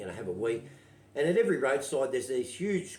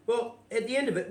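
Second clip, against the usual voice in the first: ordinary delivery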